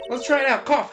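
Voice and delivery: put-on perky high girl voice; bright eager girl voice; high Maya voice. Voicing Maya.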